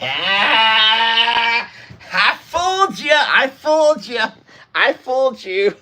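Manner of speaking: high, gloating I fooled ya; sing-song victory tease; gleeful tease. Real, not acted. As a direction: crow over the trick, laughing. Teasing viewers after hiding.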